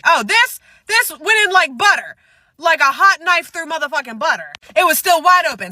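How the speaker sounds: high and loud, thrilled; excited. Real, not acted. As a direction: gush about it, delighted. Excited about the piercing.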